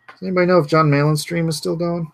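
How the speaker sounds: usual speaking voice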